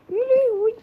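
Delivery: high, loud yelp of fright; panicked cry. Real, not acted. Trapped gamer crying out in fright.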